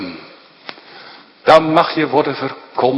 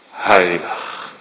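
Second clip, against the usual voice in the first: normal talking voice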